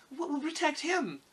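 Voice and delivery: put-on high-pitched worried child; anxious. A narrator's reading. Dorothy fears for Toto, high anxious girl's voice. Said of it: "said the girl anxiously".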